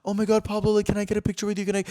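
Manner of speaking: high, eager squeal; excited fans. Retold or imitated. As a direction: mimic fans crowding in for a selfie. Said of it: "they're like".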